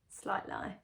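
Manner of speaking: ordinary delivery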